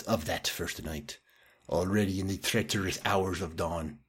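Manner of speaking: soft, measured film voice-over; closing narration. Retold or imitated. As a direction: read it as the film's hushed, solemn epilogue. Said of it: "this is the closing narration".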